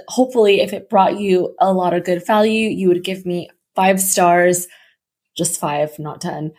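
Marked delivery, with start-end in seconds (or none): 5.4-6.5 dropped low and much softer, teasing; a quick cheeky murmur to the listeners; playful aside. Real, not acted. Asking podcast listeners for reviews, softly teasing. Joking aside about star rating.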